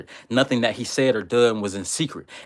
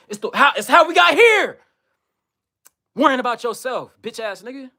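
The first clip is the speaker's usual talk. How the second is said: furious rant; 10 semitones higher than usual. Real, not acted. Ranting at a commenter who said do for thyself, furious and high-pitched. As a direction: tear into the commenter at full rage.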